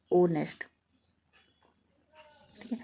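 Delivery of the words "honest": ऑनेस्ट alone, opening on the o vowel; silent H in ऑनेस्ट. Shown as a demonstration. Teacher repeating the correct form for students, ऑनेस्ट without h. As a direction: say ऑनेस्ट as "o-nest", without any h. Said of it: "ये ऑनेस्ट होता है".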